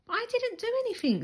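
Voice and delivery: very high, fast whine; wrongly accused brother protesting; whiny protest. A narrator's reading. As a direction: squeal out his innocence. Henry protests innocence.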